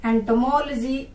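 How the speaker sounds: एंटोमोलॉजी as ento-MOL-jee; corrected pronunciation. Shown as a demonstration. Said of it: "जी अलग हो जाएगा". (एंटोमोलॉजी को एंटोमोल-जी बोलकर)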